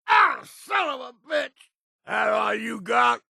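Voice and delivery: hurt, defiant battle cry; pained angry shout. Acted. Hit in combat, cursing.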